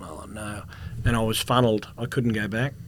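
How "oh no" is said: whispered "uh-oh" to himself; hushed dismay. Retold or imitated. Traveller to himself at the x-ray, hushed dismay. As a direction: mutter it very softly under the breath.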